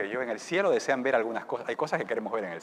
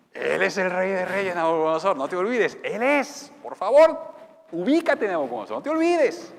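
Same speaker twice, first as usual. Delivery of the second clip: loud, higher warning to the king; loud rebuke; 6 semitones higher, 12 dB louder than usual. Acted. Preacher shouting a warning to Nabucodonosor. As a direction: call the king to order.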